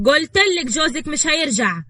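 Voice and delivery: high, loud and slow; loud, drawn-out rebuke; angry insistence. A narrator's reading. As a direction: drive it home in an argument. Acted family quarrel, insisting.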